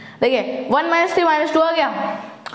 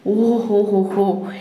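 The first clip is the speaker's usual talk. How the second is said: amused hoho; 4 semitones lower, much slower than usual. Acted. Reacting to his own Bhojpuri slip.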